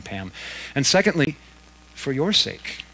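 ordinary talk, nothing marked

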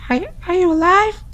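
high put-on girly voice; girl voice. Yama's friend calling through the door in a high girl voice. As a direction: pitch way up into a girl's voice. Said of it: "my girl voice".

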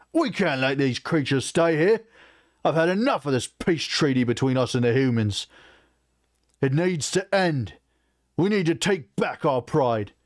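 high-pitched, indignant tirade; furious orc outburst. Acted. War chief demanding an end to peace treaty, furious. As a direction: rage against the decision, pitched well up.

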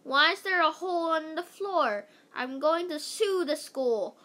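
mad shout. A narrator's reading. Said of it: "Missus Mentry shouted, pretty mad".

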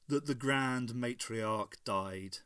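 plain reading voice

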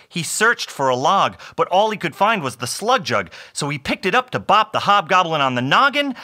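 narration rising in pitch to a peak; storyteller winding up the action; building suspense. A narrator's reading. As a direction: build excitedly toward the blow.

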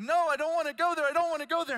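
high, loud, fast mock tantrum; upset small child. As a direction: imitate a panicked little boy refusing, voice shooting up. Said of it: "And he starts crying".

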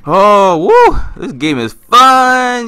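loud, high mock whoop; sarcastic cheer. Real, not acted. Gamer mock-cheering to viewers about the scary game.

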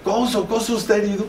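high calling shout of a wounded friend's name; retold shout. Italian colleague shouting that Couso is hurt, high alarmed call. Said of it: "empieza a gritar".

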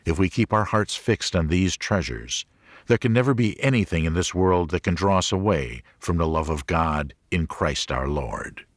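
ordinary delivery

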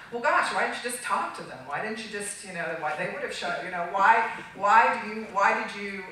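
mock reproach. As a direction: put on an exasperated, questioning critic. Voices critics' reproach in retelling.

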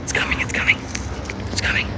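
urgent whisper, repeating it. Real, not acted. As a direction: whisper it tensely while hiding. Hiding in bushes, train approaches.

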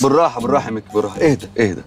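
low, calming reassurance; low-pitched "take it easy" tone; soothing. Acted. Calming Mekky down.

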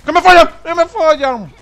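screaming at the screen; furious shout. Real, not acted. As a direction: yell it in real rage. Getting killed in game.

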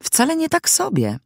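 high-pitched, emphatic protest; exclaimed with conviction. A narrator's reading. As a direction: contradict it eagerly, pitch leaping up. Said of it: "zawołał z przekonaniem".

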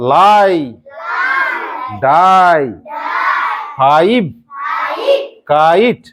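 "লাই, ডাই, ফাইভ, কাইট" one by one, each with a full eye glide; pronunciation drill. Shown as a demonstration. Teacher giving words to repeat, "লাই, ডাই, ফাইভ, কাইট" each stressed on the aa-ee glide. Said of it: "উচ্চারণ করো".

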